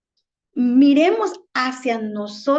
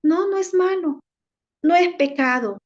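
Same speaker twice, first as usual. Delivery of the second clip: higher, lightly voiced tempter; Satanás tentador; 5 semitones higher than usual. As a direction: play the devil lightly, coaxing in a higher pitch. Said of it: "Satanás viene y dice así".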